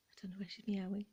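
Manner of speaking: soft unvoiced whisper; whispered aside. Real, not acted. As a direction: murmur it as a side note. Aside about the cat.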